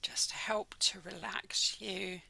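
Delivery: measured, calm delivery, letting each phrase settle; guided meditation. Acted. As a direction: guide listeners gently into the body scan, unhurried. Leads a guided body scan meditation.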